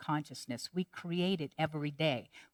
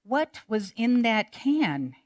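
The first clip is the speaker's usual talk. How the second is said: amazed; 5 semitones higher, 6 dB louder, slower than usual. Retold or imitated. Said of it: "the man's like".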